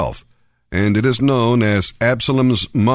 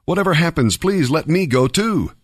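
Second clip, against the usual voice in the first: higher-pitched, earnest plea; pleading; 6 semitones higher than usual. A narrator's reading. Ahimaaz begs Joab to let him run too, pleading. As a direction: plead in a raised voice. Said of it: "continued to plead with Joab".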